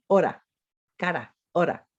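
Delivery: hora and cara with a tapped Spanish R; Spanish flap r. Shown as a demonstration. Teacher showing Spanish flap R, tapped lightly. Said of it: "we pronounce it cara".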